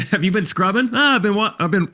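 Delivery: light skit voice; punning mock dialogue. Punning dialogue on the town name.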